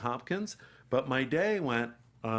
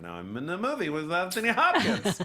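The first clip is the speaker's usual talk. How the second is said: higher, louder gleeful boast, swelling into laughter; mock excitement; 8 semitones higher, 6 dB louder than usual. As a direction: imitate an overexcited newcomer. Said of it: "he's figuring in his head".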